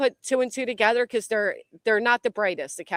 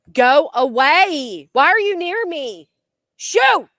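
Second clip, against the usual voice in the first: loud, higher, slow shout to scare it off; shooing shout; 5 semitones higher, 7 dB louder, slower than usual. Real, not acted. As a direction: chase the animal away. Shooing a squirrel away.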